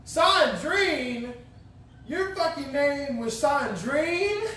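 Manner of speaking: high, drawn-out disbelief; mocking high-pitched astonishment; incredulous. Real, not acted. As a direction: stretch it out high, utterly amazed. Incredulous at friend's French class name.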